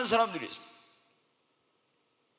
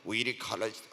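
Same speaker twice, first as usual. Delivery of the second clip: much softer, lower, listless tongues; half-hearted tongues; 7 semitones lower, 8 dB quieter than usual. Shown as a demonstration. Preacher showing tongues prayed by his own will, listless and soft. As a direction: mumble it without any strength. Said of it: "그냥 할 때는".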